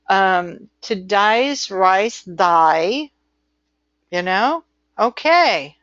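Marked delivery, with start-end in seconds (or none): 0.8-3.1 slow, drawn-out mock Australian; exaggerated Australian day sounding like die; Australian accent. Shown as a demonstration. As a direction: stretch the vowels in an Australian accent. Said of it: "The Australian lingo is just different".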